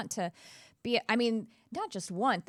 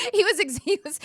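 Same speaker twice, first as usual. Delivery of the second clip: laughing; 12 semitones higher, 7 dB louder than usual. Real, not acted.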